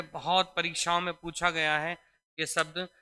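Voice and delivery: ordinary delivery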